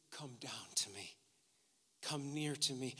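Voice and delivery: very soft, low, near whisper; hushed invitation. Acted. Joseph calling his family to him, hushed invitation. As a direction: beckon them gently in a hush.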